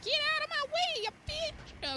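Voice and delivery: high, loud screech; fast, squealed road-rage outburst; excited yell. Real, not acted. Driver yelling at whoever blocks the car, excited yell. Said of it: "I'm getting excited".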